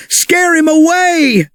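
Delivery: high eager shout-out; eager urging. Acted. Trixie urging Rex to roar, high eager call. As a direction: push him on with excitement. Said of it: "said Trixie".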